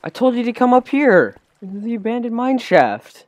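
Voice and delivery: loud, high exasperated shout; frustrated yell. Real, not acted. Frustrated at teammate.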